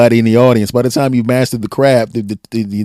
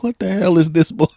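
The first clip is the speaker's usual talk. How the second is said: imitated incredulous crowd; 7 semitones higher than usual. Mimics older college crowd's reaction.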